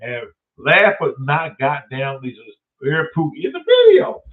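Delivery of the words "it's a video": loud, high, hyped announcement; excited. Real, not acted. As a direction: shout it out like a hype man. Excited hype intro of Harry.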